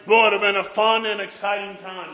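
loud, high preacher's fervour; fired-up revival preaching; preaching at full force. Acted. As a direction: build the sermon to a roaring climax.

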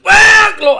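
high, explosive preacher's whoop; Pentecostal shouting. Retold or imitated. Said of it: "he shouted like an old Pentecostal".

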